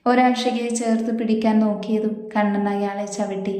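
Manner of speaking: usual speaking voice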